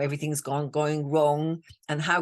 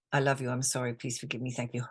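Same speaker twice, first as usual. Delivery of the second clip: soft mantra; 5 semitones lower, 6 dB quieter, faster than usual. Shown as a demonstration. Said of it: "then the Hawaiian".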